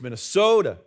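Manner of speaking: Minnesota accent, stressed and stretched SO in Minnesota. Shown as a demonstration. Preacher demonstrating how locals say it, exaggerated Minnesota accent. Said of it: "when you're from Minnesota".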